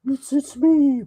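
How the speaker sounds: high, slow creepy clown voice with a tearful end; drawn-out sinister clown, then a sob in it; Pennywise voice. Acted. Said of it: "give me the daddy quote again".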